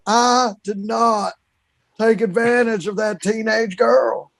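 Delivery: slow, drawn-out Southern politician; Bill Clinton. Acted. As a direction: draw every word out in a Southern impersonation. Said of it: "Bill Clinton was like".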